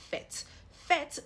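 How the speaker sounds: fête as "fet", short open eh; open E sound. Shown as a demonstration. Teacher showing learners the grave accent, open E sound. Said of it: "I've pronounced it as a E sound".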